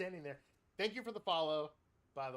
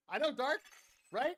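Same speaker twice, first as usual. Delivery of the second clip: higher, loud excited call-out; 11 semitones higher, 10 dB louder than usual. Real, not acted. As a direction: call it out to a friend. Greets viewer loudly.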